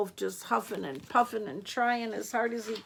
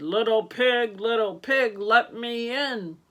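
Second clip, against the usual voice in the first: wolf voice; 2 semitones higher, 6 dB louder than usual. Acted. Wolf voiced asking at door.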